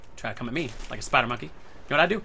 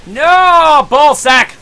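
high, very loud cry of frustration; frustrated yell; 12 semitones higher, 16 dB louder, much slower than usual. Real, not acted. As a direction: yell out at the game in dismay. Frustrated yell at losing in game.

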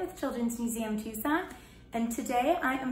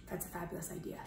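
usual speaking voice

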